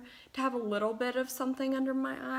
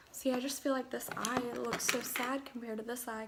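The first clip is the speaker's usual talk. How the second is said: normal talking voice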